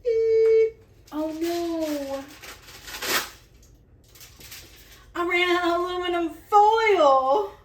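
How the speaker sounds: loud high-pitched panic; alarmed. Real, not acted. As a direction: squeal it in alarm. Runs out of foil.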